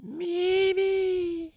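very high, loud drawn-out yell. A narrator's reading. Peeves bellows for the ghost Mimi, yelling. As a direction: shriek the name at the top. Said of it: "prit une profonde inspiration et hurla".

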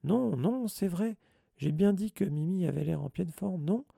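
high, hasty-sounding reassurance; anxious insistence. A narrator's reading. Hermione nudges Ron and Harry to agree, insistent.

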